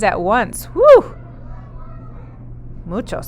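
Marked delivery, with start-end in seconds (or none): 0.7-1.1 high, loud amazed whew. Real, not acted. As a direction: sound truly amazed at the number. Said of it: "reacting to fourteen babies".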